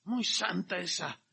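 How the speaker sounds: lightly mocking sarcasm. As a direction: sneer at the woman. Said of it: "Estaban indignados".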